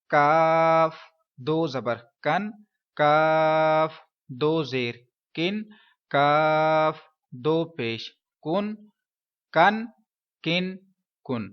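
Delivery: کاف دو زبر کن spelled slowly, each part drawn out; slow recitation teaching تنوین, کاف said thin; spelling drill. Shown as a demonstration. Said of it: "اب ذرا اس کی پروننسیشن سن لیتے ہیں". (ہجوں کے ساتھ آہستہ تلفظ)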